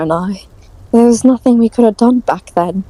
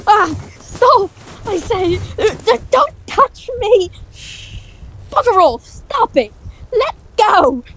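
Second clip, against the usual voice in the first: panicked struggle; 10 semitones higher than usual. Acted. Struggling as someone grabs him.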